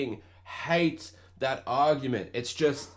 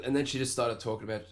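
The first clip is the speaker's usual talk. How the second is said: usual speaking voice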